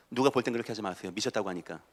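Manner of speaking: ordinary talk, nothing marked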